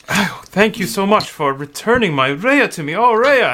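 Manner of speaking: father thanking the party for his daughter; grateful father. Acted. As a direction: thank them with a catch of relief.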